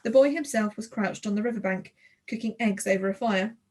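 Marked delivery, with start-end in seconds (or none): none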